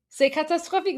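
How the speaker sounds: c'est catastrophique in a put-on French accent; imitating a French speaker; mock French. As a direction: faux French wail. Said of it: "Armin is doing".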